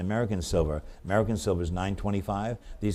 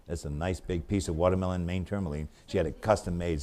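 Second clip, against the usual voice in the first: usual speaking voice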